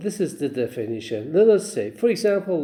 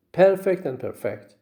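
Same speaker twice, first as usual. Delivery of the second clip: first perfect stressed up front, second perfect at the end; contrasting syllable stress; 5 semitones higher than usual. Shown as a demonstration. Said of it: "stress on the first syllable".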